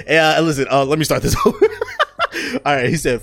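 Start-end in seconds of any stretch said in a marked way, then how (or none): 1.6-2.6 sudden squeaky laugh; high burst of laughter. Real, not acted. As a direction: break up laughing.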